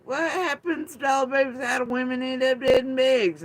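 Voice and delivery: very high, loud mock outrage. Mimicking Ryan's fake concern, high shriek. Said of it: "Ryan's like".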